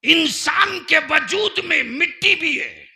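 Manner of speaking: high, loud oratorical shout; full-force preaching. Real, not acted. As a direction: challenge the crowd at the top of your voice.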